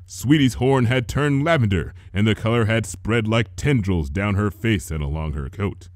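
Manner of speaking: usual speaking voice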